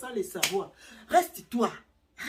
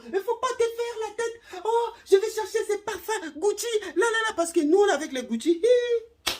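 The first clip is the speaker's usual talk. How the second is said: high, laughing squeal of delight over gifts; much higher, giggling and louder; giddy excitement; 12 semitones higher, 4 dB louder than usual. Retold or imitated. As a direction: gush like she just got Gucci perfume. Woman thrilled by Gucci gifts.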